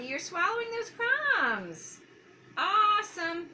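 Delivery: high, slow, drawn-out cheering; excited praise. Real, not acted. Therapist cheering a child swallowing crumbs, high and slow.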